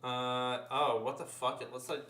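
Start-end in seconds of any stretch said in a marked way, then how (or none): none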